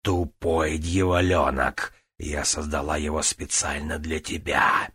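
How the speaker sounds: slow snarling demon queen; angry demon voice. Acted. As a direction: spit the insult like a demon. Ксалтарат в гневе на Джанель. (гневный голос демона)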